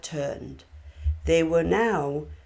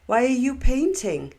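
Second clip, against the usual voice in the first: raised, higher voice carrying across the room; calling out; 7 semitones higher, 7 dB louder than usual. A narrator's reading. Narrator calling to October painting in the window, raised voice. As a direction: call it over to her at the window. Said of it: "I called to her and asked".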